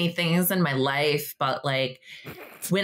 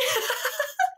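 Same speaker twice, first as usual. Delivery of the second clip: a high burst of laughter. Real, not acted. Laughing at the clerk's lost sale, high laugh.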